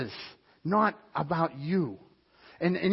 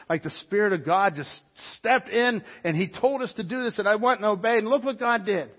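higher and louder, overjoyed telling; 7 semitones higher, 7 dB louder than usual. Retold or imitated. Telling his wife on the phone, higher and overjoyed. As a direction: spill the amazing news.

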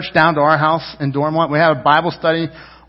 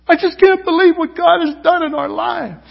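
tearful friend; 11 semitones higher than usual. Retold or imitated. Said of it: "like, crying".

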